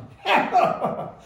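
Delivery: a high, loud mocking laugh; scornful laugh. A narrator's reading. Butler laughs scornfully at Sam, mocking. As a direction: laugh in his captor's face. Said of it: "lachte Butler höhnisch".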